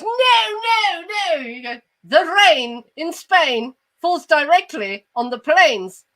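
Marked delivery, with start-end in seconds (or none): 0.0-1.4 high, drawn-out posh objection; hammed-up posh English tutor correcting; plummy, sing-out upper-class protest; posh guy. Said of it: "the posh guy comes in and he goes". 2.0-6.0 slow, higher posh English elocution; over-enunciate like a posh tutor; exaggerated upper-class accent, word by word; posh guy. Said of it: "the posh guy comes in".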